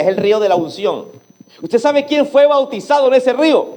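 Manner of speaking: ordinary talk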